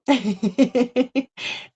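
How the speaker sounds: high-pitched real giggle. Real, not acted. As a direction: giggle lightly at your own silliness. Own giggle while waving the bear.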